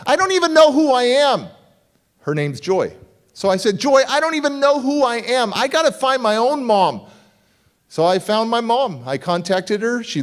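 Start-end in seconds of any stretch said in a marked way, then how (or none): 0.1-1.5 panicked. Retold or imitated. Repeating the panic. 3.8-7.0 distraught. Retold or imitated. Telling wife his crisis.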